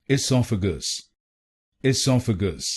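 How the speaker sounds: "esophagus" with the second syllable sof stressed. Shown as a demonstration. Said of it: "दूसरे शब्दांश पर ... जोर डालना है".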